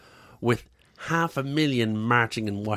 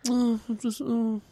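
much higher, whining; mock whiny Trump; 6 semitones higher than usual. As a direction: whine like a self-pitying president.

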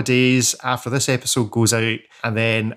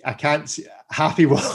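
ordinary talk, nothing marked

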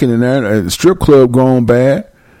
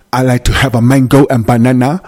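hammed-up imitation African accent; mock African accent. American mocking expats at a Ghana fruit stand, mock African accent. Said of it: "you ain't gotta talk like that".

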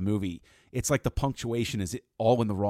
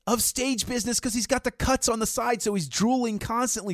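much higher, excited gushing; gushing praise; 14 semitones higher, 5 dB louder than usual. Real, not acted. Fan praising Ledger's lip licking to cohost, high and excited. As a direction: rave about it in delight.

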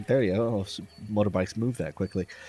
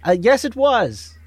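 nervous character voice; 9 semitones higher, 5 dB louder than usual. Acted. Player voices his character.

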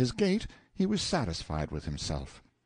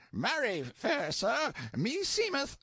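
put-on higher, slow-witted old man; a stupid ancient servant, voiced higher; dim old man voice; slower than usual. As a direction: play him dull and vacant, pitched up.

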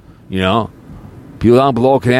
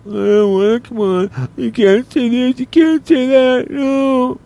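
very high, drawn-out mocking voice; hammed-up squeal of cowardly excuses; mock chicken; slower than usual. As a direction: mimic a coward backing out, way up high. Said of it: "But he chickened out".

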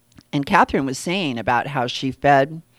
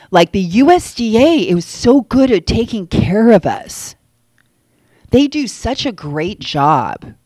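sarcastic; 5 semitones higher, 6 dB louder than usual. Real, not acted. Mocking praise of the USDA.

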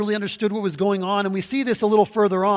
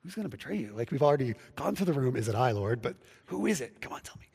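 hushed secret whisper; 4 semitones lower, 7 dB quieter than usual. Retold or imitated. John secretly asking Jesus at the table, whispered. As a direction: whisper it so no one else hears. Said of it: "John leans in secretly".

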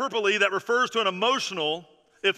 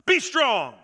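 be strong, louder and higher, hammed-up macho order; lightly voiced tough-love command; mock tough-guy advice; 5 semitones higher, 4 dB louder than usual. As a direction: bark be strong like a gruff old-school coach. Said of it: "that says".

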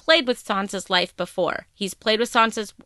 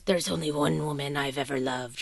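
low, softer, throaty schemer impression; Littlefinger, throaty plan voice; 6 semitones lower, 7 dB quieter than usual. Littlefinger to Lysa before the moon door, throaty plan voice. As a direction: drop it low and throaty, quietly plotting. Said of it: "his throaty plan voice".

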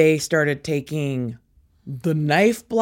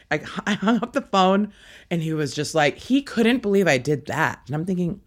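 usual speaking voice

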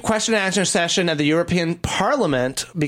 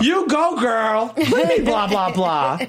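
excited cheer; 4 semitones higher than usual. Real, not acted. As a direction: cheer her on, sassy. Cheering, then mock outrage.

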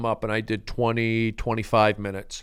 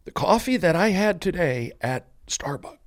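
higher, mock-moved delivery; hammed-up emotional scene, pitched up; put-on emotional; 5 semitones higher than usual. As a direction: play at being overcome by feeling. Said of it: "forgive me if I get emotional".

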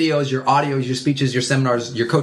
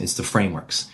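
ordinary talk, nothing marked

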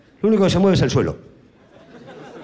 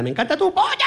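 shouted in capitals; 12 semitones higher than usual. Shown as a demonstration. Comic shows a text in capitals, shouting high. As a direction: shout "Me encanta tu polla" like all-caps text. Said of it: "Polla con mayúsculas".

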